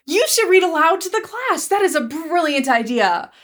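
gasp, then gush, high-pitched; excited teacher. Retold or imitated. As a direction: imitate a teacher thrilled by a sudden idea. Acting out the delighted teacher.

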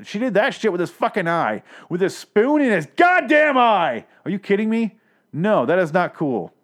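high, indignant shouting; outraged yelling. Real, not acted. Podcaster ranting to listeners about spoon in eye, outraged yell. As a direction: yell it in appalled disbelief. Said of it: "Sorry for yelling".

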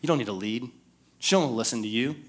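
soft voice of the tempting lie; hushed coaxing lie. Retold or imitated. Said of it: "God starts to whisper to you".

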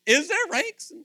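high, loud mock-innocent asking; a newcomer in heaven innocently asking about ranks; innocent question. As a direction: play it wide-eyed and innocent. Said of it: "I'm like".

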